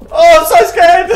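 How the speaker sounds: high, loud hammed-up fright; mock scared. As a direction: squeal it like a fake panicked victim. Mock fear at other players.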